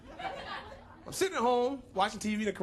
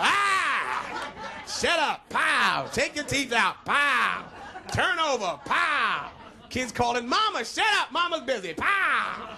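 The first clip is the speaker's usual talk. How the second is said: loud, higher hammed-up yelling; rowdy shouting; 4 semitones higher, 3 dB louder, much slower than usual. Comic acting out a wild bedroom scene, loud shouts. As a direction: bark out the commands with comic punch.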